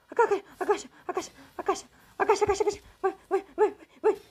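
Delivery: frantic high urging; panicked high-pitched pleading; being chased. Real, not acted. Hiding from monster, frantic.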